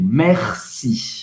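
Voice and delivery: "Merci" with a throaty French R in the middle; "Merci" falling and careful, the R rolled back in the throat. Shown as a demonstration. Teacher shows the learner how to say thank you. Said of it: "você vai pronunciar o R no meio".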